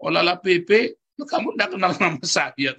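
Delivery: high-pitched mock indignation; indignant protest. Husband to forgetful wife, retold indignant protest. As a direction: protest in high, exaggerated disbelief. Retold quarrel between married couple.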